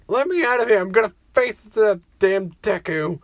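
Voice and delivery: yelling angrily, pitch up; angry shout. Acted. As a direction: shout it like a furious teen. Angry Bakugo wants out.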